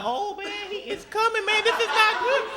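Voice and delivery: high-pitched nervous fretting; panicked. Acted. Driver to himself as the angry officer comes, panicked.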